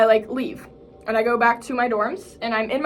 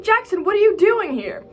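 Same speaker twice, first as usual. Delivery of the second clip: high-pitched surprised greeting; mock astonishment; 9 semitones higher, 4 dB louder than usual. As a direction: pitch it up in playful disbelief. Retells bumping into a friend unexpectedly.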